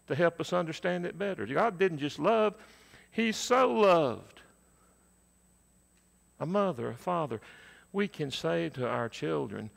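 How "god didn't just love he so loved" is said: so lifted high and loud, slowed; stress on so. Shown as a demonstration. Preacher to congregation, punching so. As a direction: hit the word so hard and slow. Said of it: "he uses that adverb so".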